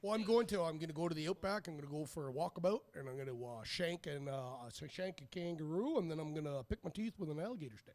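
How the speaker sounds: put-on Australian accent, comic attempt; amateur mock-Australian accent. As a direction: ham up an Aussie accent. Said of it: "in your best Australian accent".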